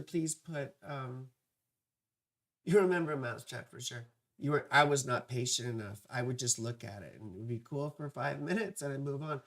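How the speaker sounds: ordinary talk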